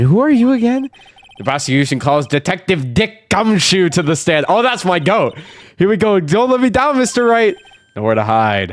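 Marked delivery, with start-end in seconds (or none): 0.0-0.9 put-on girl voice. 5.8-7.6 put-on high-pitched young woman voice; a girl's high, upset voice. Voices the girl client.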